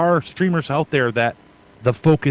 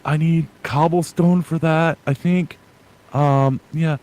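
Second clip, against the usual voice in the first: narrating to himself, YouTube-like; mock self-narrating gamer; imitating old narration; 4 semitones higher, slower than usual. Streamer recalls talking at the audience, lightly acted. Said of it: "just basically narrating what I was doing".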